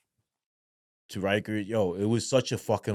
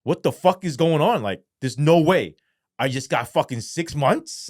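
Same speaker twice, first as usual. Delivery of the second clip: higher, stretched-out retold astonishment; surreal disbelief; 5 semitones higher, 4 dB louder than usual. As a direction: relive it stunned, stretching the key words. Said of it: "it was so surreal".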